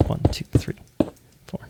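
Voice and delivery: counting blocks in a whisper. Real, not acted. Builder counts blocks under his breath, whispered.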